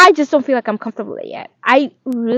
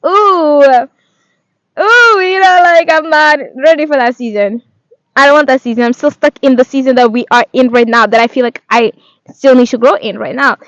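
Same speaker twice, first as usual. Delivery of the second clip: whiny protest; 2 semitones higher, 8 dB louder than usual. Retold or imitated. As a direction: whine like a kid refusing to go. Acting out her reluctance to God.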